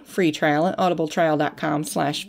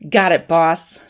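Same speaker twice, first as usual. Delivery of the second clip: tight, louder gritted-teeth reply; through gritted teeth; 5 dB louder, slower than usual. A narrator's reading. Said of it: "Jack spoke through gritted teeth".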